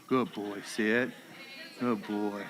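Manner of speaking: very low, soft praise; praising a dog. Real, not acted. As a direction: reward the dog in a hushed, deep tone. Praising dog after recall.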